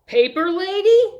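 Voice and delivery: high, loud rising question; eager young paper seller calling out; newsboy's sales call. A narrator's reading. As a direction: pipe it up like a newsboy hawking.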